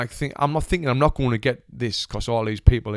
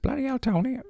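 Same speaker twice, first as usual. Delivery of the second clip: much higher, softer, badly faked American accent; failed American accent; 13 semitones higher, 6 dB quieter than usual. Agent rings Tony after the audition, badly faked American accent. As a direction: have a hopeless go at sounding American. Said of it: "I can't do American accent".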